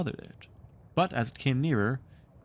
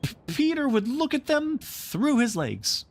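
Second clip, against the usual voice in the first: put-on high eager boys' cry; much higher boys' voices; 14 semitones higher than usual. A narrator's reading. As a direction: blurt the idea in relief. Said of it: "they cried".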